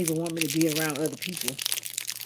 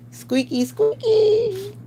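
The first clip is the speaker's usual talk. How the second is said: high squeaky put-on voice; silly high-pitched squeaking; playful squeak; 4 dB louder, much slower than usual. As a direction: squeal it, climbing higher on the second word. Playful squeaking after laughing.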